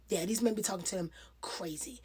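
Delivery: hushed, breathy whisper of disbelief; soft whispered remark to the viewers; whispered aside. Real, not acted. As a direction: whisper it like a side comment. Aside about the men.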